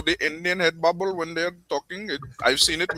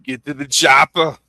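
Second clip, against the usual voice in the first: louder Austrian movie-hero bark; Schwarzenegger impression; 8 dB louder than usual. Friend quoting Schwarzenegger in Austrian accent.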